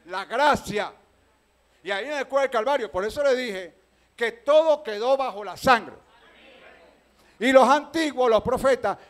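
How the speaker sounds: loud, driving sermon voice; preaching at full force. Acted. Pastor preaching loudly to the congregation about grace.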